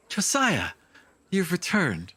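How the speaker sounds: put-on high, bright child calling out a name; a small girl hugging her returned brother; little girl voice. Little sister greets her brother, high child voice.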